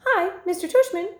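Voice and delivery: high put-on old lady announcing over a loudspeaker; dad pages the principal in a high, fake old lady voice. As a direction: mimic a fussy old lady paging someone. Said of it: "fake high old lady voice".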